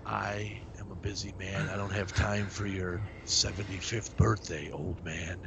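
hushed, menacing mock whisper; whispered tough guy. Whispered Seagal cameo line.

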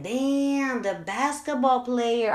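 high, slow oh-that-guy; a touch of embarrassed recognition; mild surprised realization. Retold or imitated. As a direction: mom realizing it was the basketball player. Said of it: "And I was like".

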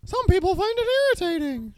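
high, fast squeaky cartoon voice; mock cartoon voice played for laughs; ridiculous voice. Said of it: "in a ridiculous voice".

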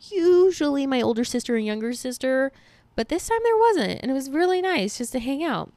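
high, bright disbelief; surprised. Real, not acted. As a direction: sound amazed it went well. Surprised nobody argued.

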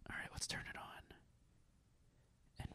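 whispered aside to himself. Real, not acted. Host turning on his air purifier, whispering. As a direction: breathe the words under your breath. Muttering while plugging in purifier.

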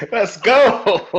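laughing, high and loud; laughing cheer. Real, not acted. Laughing hype reaction.

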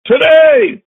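shouted proclamation. Acted.

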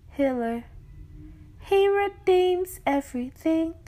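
fervent, slow pleading from the reflection; impassioned spoken word. Acted. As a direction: build it like a pulpit appeal.

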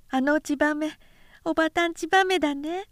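put-on high, lisping toddler voice; high childish babble; small child. A narrator's reading. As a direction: chirp it like a little boy proud of himself. Toddler points at swallow.

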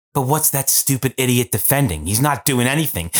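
ordinary delivery

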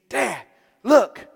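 put-on excited kid. Excited son showing card.

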